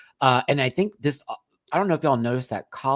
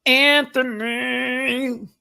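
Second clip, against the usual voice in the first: drawn-out name call; 12 semitones higher, 7 dB louder, much slower than usual. Pretend. Reading a viewer's name, hamming it up very slowly. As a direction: stretch the name out high and slow. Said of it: "That sounded terrible".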